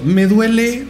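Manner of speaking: pained cry. Retold or imitated. Retelling a painful attack.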